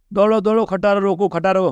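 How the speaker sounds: imitating a yell for help, half-performed; retold panicked shout. Wife yelling for someone to stop the runaway truck, retold alarmed shout. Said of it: "એ બૂમ પાડતો".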